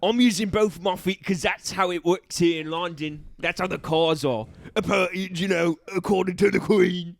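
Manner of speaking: chatty mock London cabbie; Cockney accent. London driver explaining his car to a passenger, Cockney. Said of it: "I'm gonna do an accent".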